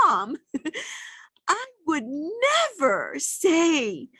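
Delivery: acted child's disbelief, swooping up on the key words; tender, horrified innocence. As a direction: imitate a young daughter's high, earnest protest. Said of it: "with this tender, beautiful innocence".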